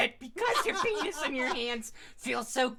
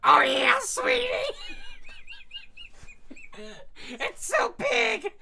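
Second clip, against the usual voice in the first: hammed-up moaning lover; 12 semitones higher than usual. Friends hamming up explicit fanfic.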